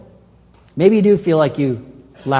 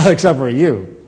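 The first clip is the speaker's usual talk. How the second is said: mock dismissive aside; 4 semitones lower, 3 dB louder than usual. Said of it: "He doesn't say".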